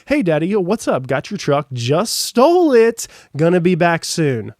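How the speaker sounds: loud, high, cocky mock greeting; mock breezy answer. To his dad who keeps calling, a loud, cocky, mock breezy reply. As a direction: ham up a carefree thief calling his dad.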